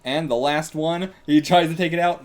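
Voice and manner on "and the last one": ordinary delivery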